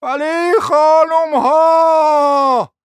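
loud, high, long-drawn wail; bellowing. A narrator's reading. Prince interrupting quarrelling ladies, bellowing slowly. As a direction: bellow over them, dragging the words out. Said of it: "امیر عربده کشید".

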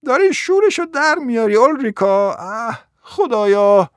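high-pitched calling through a door; exasperated yell. Acted.

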